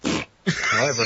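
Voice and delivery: normal talking voice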